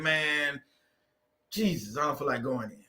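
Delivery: low, soft sigh of dread; weary dread. Retold or imitated. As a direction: groan it like the alarm just went off. Remembers 4 AM alarm.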